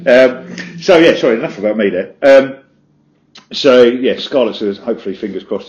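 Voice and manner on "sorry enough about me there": usual speaking voice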